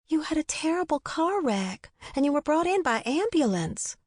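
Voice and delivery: lightly voiced talkative nurse; chatty nurse. A narrator's reading.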